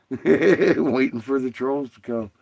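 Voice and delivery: loud, drawn-out chuckle into the words; amused, laughter spilling into speech; laughing. Real, not acted. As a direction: laugh your way through the joke. Laughs while joking about trolls.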